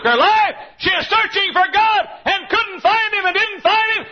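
high, loud shouted sermon, building to a peak; full-force preaching. Real, not acted. Preacher telling the church they are seeking God, impassioned shouting. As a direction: thunder it out at the congregation.

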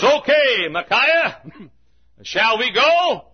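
high, loud, drawn-out royal call; hailing king. Acted. The king asks Micaiah whether to go, high hailing voice. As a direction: call it out high, stretching the last words.

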